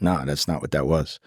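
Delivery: hushed reassurance. Retold or imitated. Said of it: "I gotta make you convinced".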